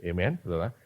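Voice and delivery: ordinary delivery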